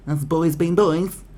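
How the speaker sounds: high, loud, slow sarcastic drawl of the excuse; sarcastic boys being boys; mocking excuse. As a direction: parody the lame excuse in a put-on voice.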